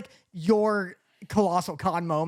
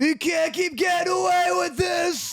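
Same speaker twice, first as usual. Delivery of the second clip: Aaron Paul impression; 9 semitones higher than usual. Friends trading Aaron Paul impressions, higher mock shout. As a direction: yell it like the meme, hammed up. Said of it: "because that's spot on".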